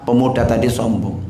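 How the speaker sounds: ordinary talk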